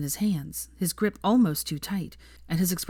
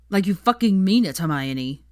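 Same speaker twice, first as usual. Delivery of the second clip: snarled; 3 semitones higher, 7 dB louder than usual. A narrator's reading. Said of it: "snarled Draco".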